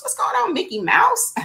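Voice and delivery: a high, playful cartoon-mouse hello; squeaky Mickey greeting. Host greets viewer named Mickey Mouse in a high cartoon voice.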